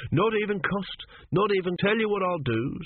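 high-pitched desperate bargaining. A narrator's reading. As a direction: scramble to save the sale. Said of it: "said Pataclasp desperately".

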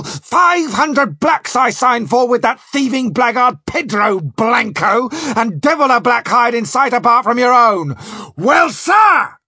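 high, loud, furious captain's tirade; storm of abuse. Acted. Spring berating Sanchez over missing cargo, storm of abuse. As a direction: rage at him, demanding answers. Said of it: "great storm of abuse from Spring".

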